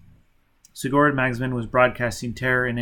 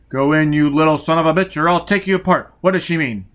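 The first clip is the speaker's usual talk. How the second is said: loud, higher, driving shouted orders; furious barked orders; angry commands; 4 semitones higher, 7 dB louder than usual. Acted. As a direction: force the child to obey. Foyle threatens child.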